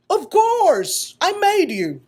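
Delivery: loud glad maker; delighted exclaim. Acted. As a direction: burst out with glad pride.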